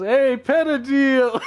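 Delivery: high, loud hail stretched out slowly; calling out. Acted. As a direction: call the bartender by name over the noise of the bar. Holden hails bartender across bar.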